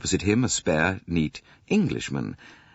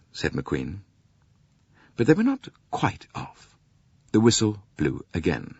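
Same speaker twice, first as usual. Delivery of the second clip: usual speaking voice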